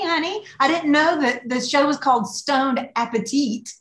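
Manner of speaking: ordinary talk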